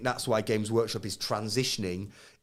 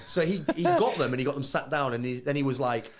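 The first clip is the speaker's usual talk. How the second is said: normal talking voice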